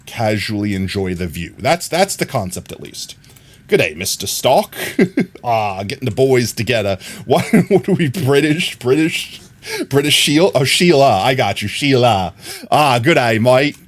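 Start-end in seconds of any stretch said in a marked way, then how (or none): 3.7-13.8 hammed-up broad Aussie accent; harsh Australian accent. Streamer greeting chat as Aussie mates, harsh Australian accent. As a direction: lay on a thick, mock Australian twang. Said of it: "Australian accent I could muster".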